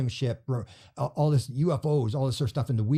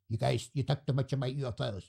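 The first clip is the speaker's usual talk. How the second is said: dismissive wife; 5 dB quieter than usual. Retold or imitated.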